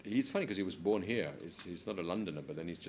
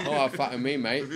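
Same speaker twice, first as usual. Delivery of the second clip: put-on laughing, higher and louder London geezer accent; mock cockney swearing; 7 semitones higher, 11 dB louder than usual. Imitating London lads swearing at a mate, laughing cockney accent. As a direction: ham up a cockney lad cursing his mate. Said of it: "especially in certain parts of London".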